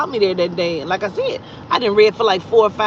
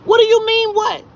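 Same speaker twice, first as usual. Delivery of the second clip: very high, loud retold outrage; incredulous comeback; 12 semitones higher, 4 dB louder than usual. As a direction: throw his answer back at him, pitch shooting up. Retold comeback to his "What?.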